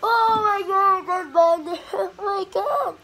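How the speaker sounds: high, loud excited gasp with a laugh; thrilled at the in-game money; excited squeal. Real, not acted. Gamer shocked by his money, excited loud squeal.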